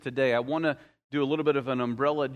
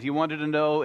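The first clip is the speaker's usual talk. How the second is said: ordinary talk, nothing marked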